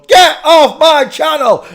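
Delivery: slow, very high, very loud hammed-up yell; mock furious shout. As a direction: scream them off the channel in mock rage. Mock rage at chat viewer.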